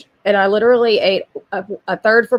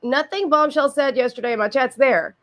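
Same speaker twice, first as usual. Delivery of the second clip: usual speaking voice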